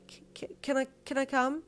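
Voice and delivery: high, stammered plea; anxious stammer. A narrator's reading. Grandchild begs paramedic to come along, stammering anxiously. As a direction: stumble into a pleading question.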